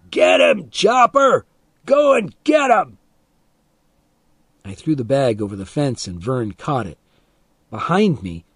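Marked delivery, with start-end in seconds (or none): 0.1-3.0 high, loud order to the dog; screamed command. A narrator's reading. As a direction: sic the dog on him, yelling. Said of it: "Milo screamed".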